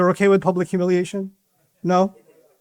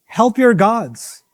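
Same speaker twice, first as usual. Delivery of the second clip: slow, higher drawn-out call to burn; slow menacing cry; 6 semitones higher, much slower than usual. Retold or imitated. The crowd demanding Ibrahim be burned, slow and grim. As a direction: drag out the crowd's cruel demand. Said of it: "They said".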